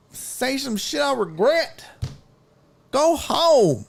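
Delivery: high, strained, cracking angry rant; half-crying fury, telling her to go home; furious pleading rant. Retold or imitated. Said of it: "I was like".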